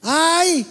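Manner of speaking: high mock cry of "¡Ay!"; reenacted pain cry; retold cry.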